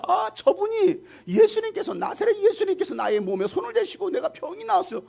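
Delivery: very high, gushing, overjoyed testimony; acted amazed joy. Healed man answering how he was cured, amazed high-pitched joy. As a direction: gush like a man thrilled by his own healing. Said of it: "너무나 놀랍고 기뻐가지고".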